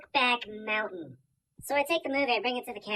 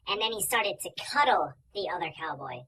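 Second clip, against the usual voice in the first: normal talking voice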